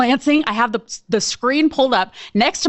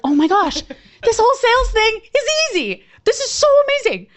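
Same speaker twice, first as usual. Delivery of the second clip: much higher, gushing excitement; thrilled; 10 semitones higher than usual. Retold or imitated. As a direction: squeal with delight. Thrilled realization sales easy.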